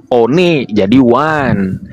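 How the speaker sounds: one said the English way, very slowly, as wun; slow it right down and sound one out in English; English pronunciation. Shown as a demonstration. Showing the opponent how to read English, slow one. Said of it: "bacalah dengan intonasi berbahasa Inggris".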